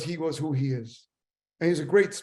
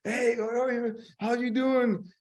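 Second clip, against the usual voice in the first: upbeat, higher friendly hello; chirpy hello to a stranger on the shuttle; cheerful greeting; 6 semitones higher than usual. Retold or imitated. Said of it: "he's very happy all the time".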